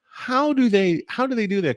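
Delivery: retold, high-pitched puzzlement; amazed question. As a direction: imitate an amazed onlooker asking.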